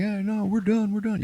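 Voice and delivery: high mock shout over noise; mock shouted reply. Leaving crew shouting over the chopper, high mock reply. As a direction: call back over the rotor.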